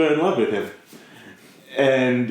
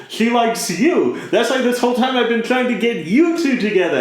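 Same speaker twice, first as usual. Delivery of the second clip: higher, rushing "That's why this whole time"; exasperated explaining; 9 semitones higher than usual. Retold or imitated. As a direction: blurt it out, fed up with his denseness.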